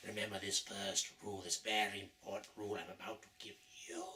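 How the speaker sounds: hushed secretive whisper; conspiratorial whisper. Acted. Villain confides rule.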